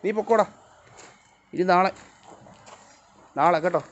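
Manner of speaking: high, loud call to leave; loud high pet-directed send-off; shooing the dog. Real, not acted. Sending dog away.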